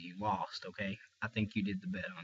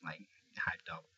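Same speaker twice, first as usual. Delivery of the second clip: fading to a whisper; 7 semitones lower, 9 dB quieter than usual. Real, not acted. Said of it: "I'm sorry I don't sound too".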